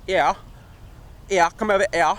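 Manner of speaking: imitating a London friend's Cockney; playful Cockney impression; Cockney accent. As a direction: drop the h in a mock Cockney accent. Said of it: "it sounds like that Cockney accent".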